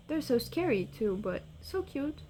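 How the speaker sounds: ordinary talk, nothing marked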